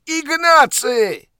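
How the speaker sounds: a huge, high, drawn-out bellow; roaring call. Acted. Piotrowicz roars for his assistant across the office, bellowing. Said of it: "ryknął".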